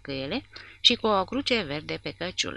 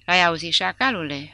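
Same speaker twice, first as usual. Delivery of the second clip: louder, sneering taunt with a grin; grinning taunt; 7 dB louder than usual. A narrator's reading. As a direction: jeer at the captive through a grin. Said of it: "rânji Porta".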